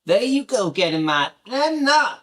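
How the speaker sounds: high-pitched mock Southern accent. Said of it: "I love her accent".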